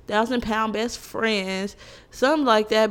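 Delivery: ordinary delivery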